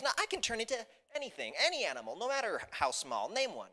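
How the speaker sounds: mildly indignant boast, high at the start; a little offended. Acted. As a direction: insist on your powers, slightly stung. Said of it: "being a little bit offended".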